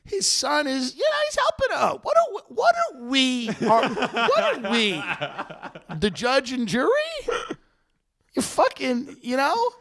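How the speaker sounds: high, rising, exasperated pleading; worked-up protest. Retold or imitated. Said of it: "And I'm like".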